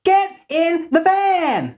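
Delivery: very high, drawn-out screech; hammed-up slow, high-pitched order; Chris Tucker. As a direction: stretch each word in a piercing squeal. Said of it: "It's Chris Tucker".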